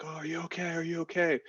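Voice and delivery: high-pitched mock concern; worried medic imitation. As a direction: retell a frantic check-in in a high voice. Voicing the medic running up worried.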